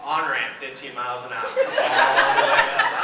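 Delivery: ordinary talk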